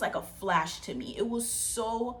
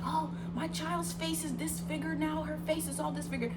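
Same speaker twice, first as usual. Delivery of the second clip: frantic; 9 semitones higher than usual. Retold or imitated. Mom seeing her child's bitten face, frantic. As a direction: imitate a distraught mother. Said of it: "my mom went crazy".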